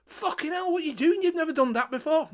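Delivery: hammed-up shocked yell at the dog; shocked bloke.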